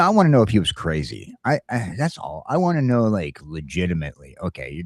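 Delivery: normal talking voice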